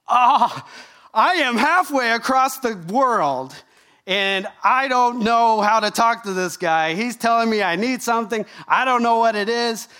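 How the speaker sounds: exasperated groan into helpless complaint. Retold or imitated. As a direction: act out being at your wits' end. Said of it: "I was so frustrated".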